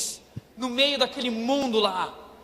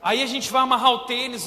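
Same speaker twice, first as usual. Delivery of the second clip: usual speaking voice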